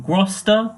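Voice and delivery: "größter" mispronounced with a plain O, like "groster"; missing umlaut. Shown as a demonstration. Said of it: "you need an umlaut".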